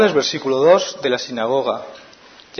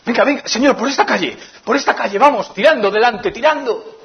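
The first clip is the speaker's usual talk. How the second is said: higher, pressing, impatient father; anxious hurrying; 8 semitones higher than usual. Retold or imitated. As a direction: rush him along. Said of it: "me lo imagino metiendo prisa". (padre angustiado metiendo prisa a Jesús)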